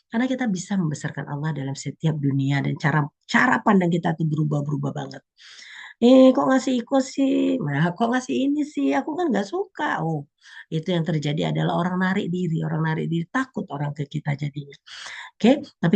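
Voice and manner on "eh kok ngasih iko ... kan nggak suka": whiny complaint. Pretend. Ungrateful receiver whining about a disliked gift, petulant. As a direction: sulk over a gift you dislike.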